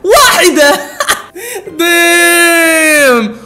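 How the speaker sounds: high, loud stretched cry and laugh; loud disbelieving victory yell; triumphant cheer. Real, not acted. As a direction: crow about the one-shot win. Solved in one drawing.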